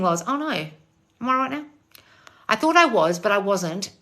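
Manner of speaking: usual speaking voice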